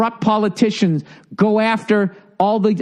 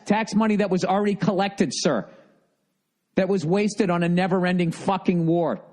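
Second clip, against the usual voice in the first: ordinary talk, nothing marked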